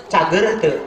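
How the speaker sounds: ordinary talk, nothing marked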